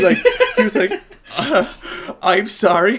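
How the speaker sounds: incredulous, high-pitched laughing retelling; loud and high, losing it; cracking up. Real, not acted. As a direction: squeal it out through real laughter. Cracking up retelling the death scene.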